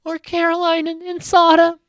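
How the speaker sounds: high, loud wailing imitation of a drunk cry; hammed-up tearful diary room confession; mock drunken cry. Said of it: "has that drunken cry thing where she's like".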